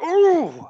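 high, loud drawn-out ooh; wincing ooh. Retold or imitated. Grandson imitating gran's reaction to atomic drops, loud wincing ooh. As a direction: imitate gran reacting loudly to a wrestling move. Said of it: "she'd be like".

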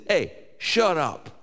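high, slow New York "shut up"; a New Yorker telling someone to can it; New York brush-off. Retold or imitated. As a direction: give it the blunt New York attitude. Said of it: "if Paul were from New York, that's how he would have".

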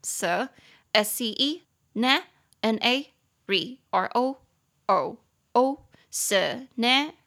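very slow, syllable by syllable; spelling out. Shown as a demonstration. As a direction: spell "scenario" letter by letter, slowly. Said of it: "四个音节".

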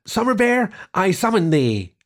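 high ringing summons into rain; calling out. A narrator's reading. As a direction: call out grandly to summon. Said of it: "called into the rain".

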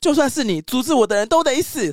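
high, loud threatening cry; fierce threat. Acted. San threatening to kill Ashitaka, fierce high cry.

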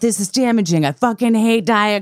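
over-the-top blunt rant. Pretend. Telling a friend off about diet culture, a mock angry rant. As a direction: ham up a harsh, fed-up scolding. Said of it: "You don't have to be like".

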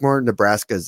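usual speaking voice

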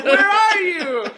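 high, hammed-up call through laughter; mock shout. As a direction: yell the name across the street, half laughing. Mock character calling out, laughing.

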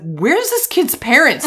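indignant demand. Retold or imitated. Looking around the museum for the child's parents, indignant and loud. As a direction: let the outrage rise as you ask. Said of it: "And I was like".

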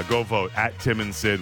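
ordinary talk, nothing marked

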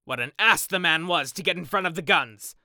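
indignant outburst; angry exclamation. Acted. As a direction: rail at someone's stupidity. Geoffrey rails at the beater.

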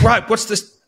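put-on high, brash American accent. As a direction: imitate an American host. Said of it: "Please do an accent".

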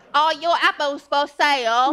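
light mock British accent; playful put-on British accent. Mock British accent flirting on the train. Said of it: "if you spoke with a British accent".

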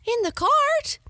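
astonished, she can't believe it; exclaimed. Acted. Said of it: "exclaimed his wife".

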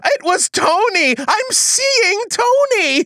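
high, bursting out the truth; finally cracking under pressure; blurted confession. Acted. Suspect blurting his secret to the detective, high and urgent.

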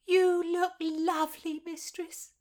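high, smiling maid's voice; smiling compliment. Acted. Maid admires her dressed mistress, smiling. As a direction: say it with a smile. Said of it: "Wynne smiled".

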